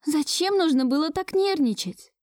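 reproachful. A narrator's reading. Musya reproaching Gleb after the doctor left, chiding. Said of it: "корила меня Муся".

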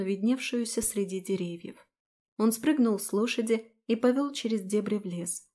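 plain reading voice

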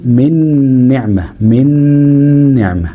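من نعمة with a held nasal hum on n; noon ghunna. Shown as a demonstration. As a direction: lean long on the joined n of من نعمة. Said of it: "مثاله مع الن".

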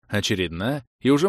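usual speaking voice

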